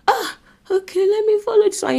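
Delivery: high, lightened acted voice; tempted giving-in. Person lured by shiny alternative, high tempted voice.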